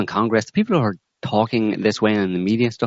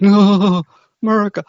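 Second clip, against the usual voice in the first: high, loud, stretched-out wail; hammed-up cry of horrified despair; mock panicked wail; 13 semitones higher, 6 dB louder than usual. Mimics panicked American reacting to Russian.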